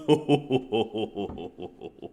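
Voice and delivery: long descending chuckle, trailing off softly; hoo-hoo chuckle falling away in a string; drawn-out chuckle. Real, not acted. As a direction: let a long chuckle tumble down and fade. Chuckles after reading Carr's warning.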